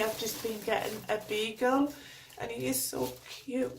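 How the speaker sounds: ordinary talk, nothing marked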